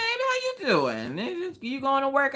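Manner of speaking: high, slow, sing-song casual greeting; imitating husband. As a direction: mimic the easygoing husband. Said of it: "He's just like".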